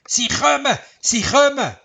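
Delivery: high, loud alarmed call to his friends; calling out a warning. Acted. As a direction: shout it out so everyone hears. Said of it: "hat seinen Freunden zugerufen".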